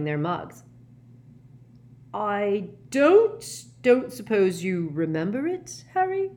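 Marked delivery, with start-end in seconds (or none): 2.1-6.3 halting, awkward question, rising high; awkwardly. A narrator's reading. Professor asks Harry about parents' death, awkwardly. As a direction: stumble and restart, ending on a high query. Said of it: "he asked awkwardly".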